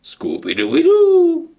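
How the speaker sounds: very high, slow cartoon dog bark-talk; high, drawn-out cartoon dog voice; Scooby-Doo impression. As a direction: sing it out like the cartoon Great Dane. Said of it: "the current voice of a talking Great Dane".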